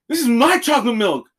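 high, indignant protest; retold indignation. Worker confronting supervisor over his milk, indignant.

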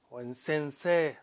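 usual speaking voice

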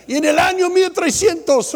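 high, loud mimicry of a wife listing old faults; hammed-up nagging wife; mocking nagging wife. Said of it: "Pero ella dice".

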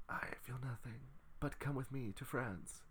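half-hearted French woman, breathy and quiet; mock French accent. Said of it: "that's not my French accent".

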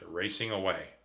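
usual speaking voice

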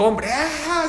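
high-pitched wail of a distraught husband; hammed-up high cry; mock screaming. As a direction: act out a man starting to scream. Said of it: "empezó a gritar".

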